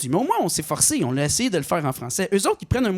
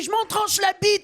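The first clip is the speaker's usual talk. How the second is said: mock vendor retort; 14 semitones higher than usual. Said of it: "qu'est-ce qu'il m'a dit?".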